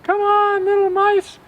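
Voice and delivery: very high, loud squeaky mouse shout; excited little mouse yelling to his brothers and sisters; mouse calling friends. A narrator's reading. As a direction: call the other mice to safety. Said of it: "yelled out to his friends".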